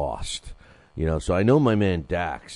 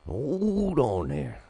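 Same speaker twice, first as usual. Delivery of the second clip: slow, higher drawled cowboy voice; old Western character; 8 semitones higher, much slower than usual. Retold or imitated. Host retrying the Western sidekick voice, slow and higher. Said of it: "now I can't do it".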